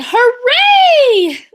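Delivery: high, loud joyful hooray; excited cheer. Acted. Girl cheers on the way to her first school day. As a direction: burst out with delight.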